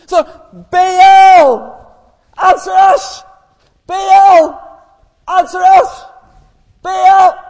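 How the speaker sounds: repeated high, loud yells; shouting. Acted. Prophets dancing for Baal's attention, shouting. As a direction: chant it at full yell. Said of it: "started getting a wee bit louder".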